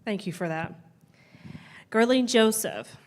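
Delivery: usual speaking voice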